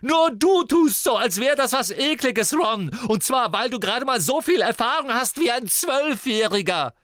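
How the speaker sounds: very high, loud, wrought-up shouting; tearful fury. A narrator's reading. Sister lashes out at brother, near tears and shouting. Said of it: "als sei sie den Tränen nahe".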